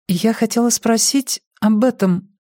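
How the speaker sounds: high-pitched, cautious newcomer's question. A narrator's reading. New girl Ильва asking the team about animal feed, cautiously. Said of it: "осторожно спросила Ильва".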